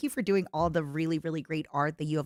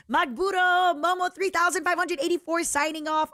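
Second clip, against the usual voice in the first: upbeat announcer signoff; 11 semitones higher, 4 dB louder than usual. Pretend. Reading signoff in voice.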